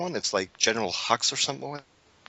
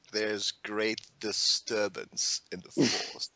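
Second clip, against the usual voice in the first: Emperor voice; 3 semitones lower, 6 dB quieter, much slower than usual. Retold or imitated. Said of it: "it's like".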